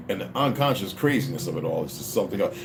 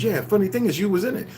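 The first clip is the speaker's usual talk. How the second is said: surprised friend; 9 semitones higher than usual. Retold or imitated. Friend admits the dream.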